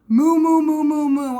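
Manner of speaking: loud playful mooing; cow moo. Shown as a demonstration. Swim coach shows kids cow bubbles, playful moo. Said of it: "blow bubbles like a cow".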